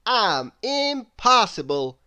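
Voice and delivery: confident booming storybook hero; Mister Impossible voice. A narrator's reading. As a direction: boom it out like a proud show-off. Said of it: "said Mister Impossible".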